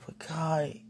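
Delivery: unvoiced whisper to God; whispered plea. Real, not acted. Speaker pleading with God, whispered. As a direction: breathe the plea out.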